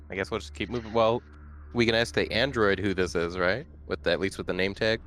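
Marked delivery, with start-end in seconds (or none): none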